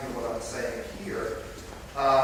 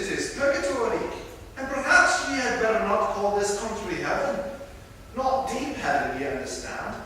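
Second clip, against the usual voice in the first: reading the Spirit in a hammy, higher Scottish brogue; bad Scottish accent; 6 semitones higher than usual. Preacher reading the Spirit's words to the congregation in a bad Scottish accent. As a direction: lift the pitch and roll it out like a bad Scottish impression. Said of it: "do a really bad Scottish accent".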